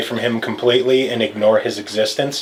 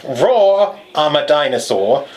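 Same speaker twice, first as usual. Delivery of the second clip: mock British accent, pitched up; 3 semitones higher, slower than usual. Shown as a demonstration. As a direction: read it like a cartoon Englishman. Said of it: "you gotta read it in a British accent".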